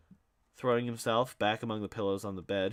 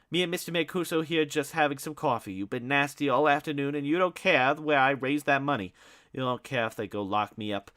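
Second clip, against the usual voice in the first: angry; 6 semitones higher, 7 dB louder than usual. Acted. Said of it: "said in an angry voice".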